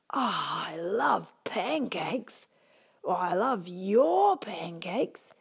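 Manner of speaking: slow, longing wolf sigh; a hungry wolf in love; dreamy wolf voice. Acted. Wolf delighted.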